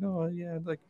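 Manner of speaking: lady cooing to pet. Retold or imitated. Rider imitating lady talking to her invisible pet, cooing. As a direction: imitate someone chatting to an imaginary pet. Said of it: "like, talking to it and everything".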